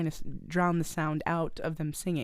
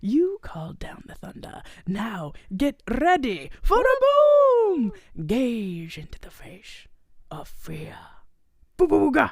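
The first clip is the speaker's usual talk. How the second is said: theatrical menace swinging from whisper to loud, slow boom; the Grinch; 4 semitones higher, 4 dB louder, slower than usual. Acted. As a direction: stretch out the threats, then burst into a mock scare. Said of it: "this is where we get the monologue".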